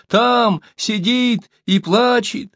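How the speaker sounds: high, stretched-out answer; storyteller voicing a panicked captive; choked, frightened cry. A narrator's reading. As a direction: plead it high and slowly in fear. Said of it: "захрипел Сибун".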